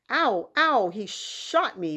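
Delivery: high, loud pained yelps; screaming in pain. Acted. Patrick screaming after the tiny cowboy shot him. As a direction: cry out as if shot. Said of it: "Patrick screamed".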